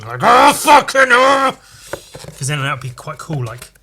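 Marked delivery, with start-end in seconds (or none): 0.2-1.6 slow loud mock scream, high and stretched; screaming face. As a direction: scream like a painted horrified face. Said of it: "like, screaming".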